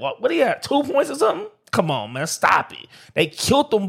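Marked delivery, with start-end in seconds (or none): 0.0-1.5 high-pitched scoffing question; incredulous ridicule. Real, not acted. Fan ridiculing a player's terrible game, incredulous. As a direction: voice shooting up in disbelief.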